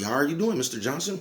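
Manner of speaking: lightly voiced fawning, sucking up to the boss; perky greeting. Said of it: "you gonna get all perky".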